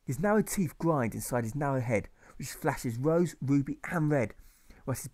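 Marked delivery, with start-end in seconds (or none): none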